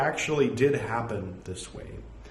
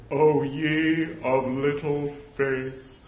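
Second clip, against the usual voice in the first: slow, intoned, grandly reproachful; mock-solemn Jesus; 3 semitones higher, 4 dB louder, much slower than usual. Shown as a demonstration. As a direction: draw it out like a grave pronouncement. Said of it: "assume that he said".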